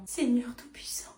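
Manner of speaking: exasperated whisper to heaven. Acted. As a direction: breathe it out, fed up, under the breath. Exasperated mother muttering. (murmure exaspéré, à voix basse)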